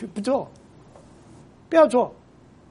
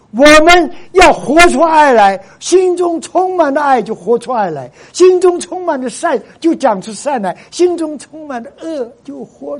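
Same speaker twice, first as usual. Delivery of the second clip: preaching climax; 5 semitones higher, 6 dB louder than usual. Real, not acted. Preacher exhorts congregation to love.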